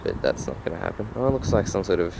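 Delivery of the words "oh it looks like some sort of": normal talking voice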